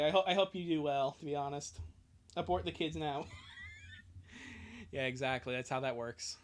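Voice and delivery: ordinary delivery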